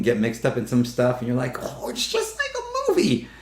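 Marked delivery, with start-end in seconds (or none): 1.6-3.3 high-pitched wowed exclamation; wide-eyed wonder, played up; mock amazement. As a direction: gush it in a high, put-on voice. Acts out an amazed reaction.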